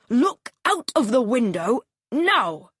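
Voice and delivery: a hint of a commanding father; stern father. A narrator's reading. Father ordering his son to look out. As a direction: order the boy firmly.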